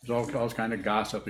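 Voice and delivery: ordinary talk, nothing marked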